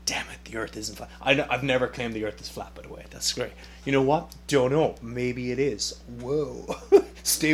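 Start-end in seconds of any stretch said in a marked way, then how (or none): 0.0-1.1 whispered grumble under the breath; grudging whisper after being corrected. Retold or imitated. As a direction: hiss it to yourself, caught out. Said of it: "I'm, like, going".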